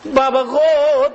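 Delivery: high, loud, slow call; drawn-out cry out. Retold or imitated. Mother embracing her living son, drawn-out high cry. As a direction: call out long and high to a beloved child.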